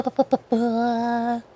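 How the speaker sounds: ordinary talk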